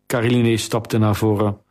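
usual speaking voice

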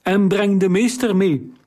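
high, fast, tearful plea; unsteady voice. A narrator's reading. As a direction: urge the captain down, voice wobbling. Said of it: "riep hij met onvaste stem".

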